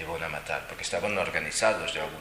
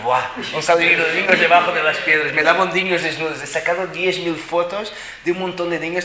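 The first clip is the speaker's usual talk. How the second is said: excited; 10 semitones higher, 12 dB louder than usual. Real, not acted. Relata emocionado la salida de niños.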